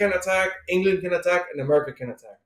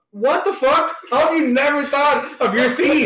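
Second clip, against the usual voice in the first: much higher, lightly exasperated disbelief; mock baffled Harley; 9 semitones higher than usual. Harley scolding Zebra Man about his theme, mock baffled and high. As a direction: play Harley's baffled outrage, pitched up.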